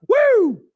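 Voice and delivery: loud, high-pitched "woo"; excited whoop. Real, not acted. Hyping up the live chat, excited whoop. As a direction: let out a big hyped whoop. Hyped cheer over Alcatraz.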